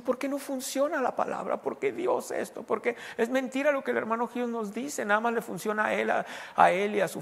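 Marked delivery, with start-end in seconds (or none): 0.0-3.0 mock crying complaint. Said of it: "están llorando y diciendo".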